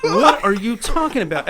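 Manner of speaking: high, loud, laughing disbelief; incredulous protest. Retold or imitated. Retelling his answer to the gun accusation, loudly incredulous. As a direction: retell being flabbergasted, half laughing and loud.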